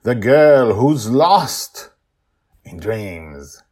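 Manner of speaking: high, loud, showy announcer read; podcast announcer intro. Acted. Host welcoming listeners back, announcer voice. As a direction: sell the show's opening like a radio host.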